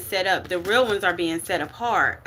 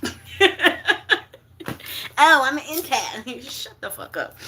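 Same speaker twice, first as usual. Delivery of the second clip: mocking show-off; 6 semitones higher than usual. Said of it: "and they be like".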